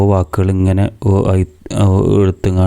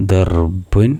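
ordinary talk, nothing marked

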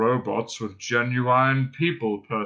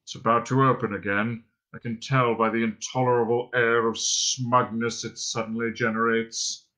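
muttering robot; 2 semitones lower than usual. A narrator's reading. Robot grumbling about the smug door, muttering. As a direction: grumble in a gloomy monotone. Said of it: "he muttered".

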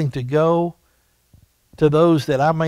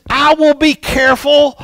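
put-on high, loud kid's shout; boy yelling back; 10 semitones higher, 5 dB louder than usual. Johnny calling back to the neighbor, high and loud. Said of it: "he yelled back and says".

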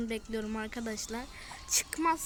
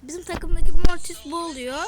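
a much louder, scornful jab at the teammate; loud complaint; 3 semitones higher, 15 dB louder than usual. Real, not acted. Gamer comparing his bad teammate to the enemy, loud scornful complaint. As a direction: yell it out in disbelief.

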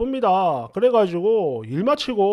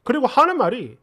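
ordinary delivery, nothing marked

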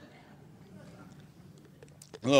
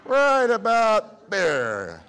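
God dropping the bill, slow and drawn out, then louder; hammed-up God; 6 semitones higher, 4 dB louder, slower than usual. Mocking God floating ten bucks down, hammed-up. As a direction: play a chuffed God running the universe. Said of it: "He's going".

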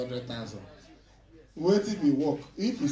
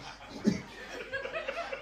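high laugh; 6 dB quieter than usual. Real, not acted. Laughing at the trainer story.

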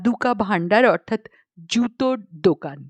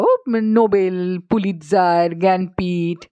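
ordinary delivery

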